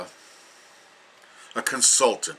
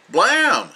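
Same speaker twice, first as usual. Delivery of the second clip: sound effect; 9 semitones higher, 5 dB louder than usual. Pretend.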